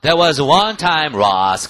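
loud, very slow "That was one time, Ross"; loud drill. Shown as a demonstration. Teacher models Rachel's reply for students. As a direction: say it clearly for the class. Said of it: "teacher drills the clip line".

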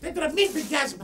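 loud, confrontational demand; angry challenge. Real, not acted. Confronting the man who threatened a beating, angry. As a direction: challenge them loudly, outraged.

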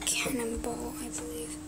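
hushed whisper. Real, not acted. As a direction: whisper the words under breath. Whispering commentary.

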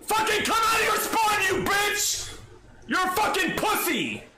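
real furious shouting at the screen; raging gamer. Real, not acted. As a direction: yell it out in full gamer rage. Raging at enemy headshots.

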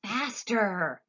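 egging the elevator on; eager cry; cried. A narrator's reading. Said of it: "cried Mister Wonka".

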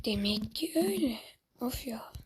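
breathy frustrated aside under the breath; whispered mutter. Real, not acted. Gamer mutters to himself after losing, whispering. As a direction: whisper it to yourself, fed up.

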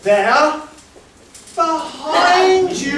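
loud, slow "behind you" shout; panto call-out. Acted. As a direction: bellow it to the audience. Classic panto call to audience.

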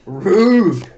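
mock offense. Real, not acted.

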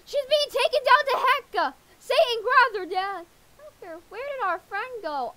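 high, loud panicked cry; alarmed shout. Acted. Kid screaming as friend is dragged down to heck, panicked. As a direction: yell it as she vanishes below.

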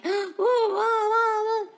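high, loud, wailing whoa-whoa; mock-startled whoas. Country mom reacting to the unsmiling cop, hammed-up alarm. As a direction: exaggerate mom's alarm in a rush of whoas.

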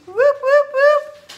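very high, loud party whoops; squealing celebratory whoops; whoop whoop cheer. Real, not acted. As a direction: hoot it like a cheering fan. Cheering over the gift card.

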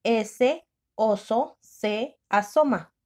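very slow, word by word; drawn-out dictation. Shown as a demonstration. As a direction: dictate slowly to the class.

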